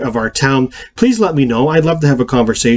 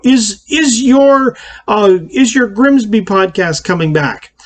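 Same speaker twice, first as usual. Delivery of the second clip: higher, drawn-out mock question; imitated questioner; 7 semitones higher than usual. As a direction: lightly imitate someone asking, pitched up and slowed. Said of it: "somebody, uh, made a comment to me".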